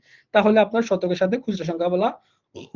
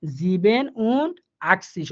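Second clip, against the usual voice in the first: 1 slow German demo; much slower than usual. Shown as a demonstration. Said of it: "কিভাবে গঠিত হয়?".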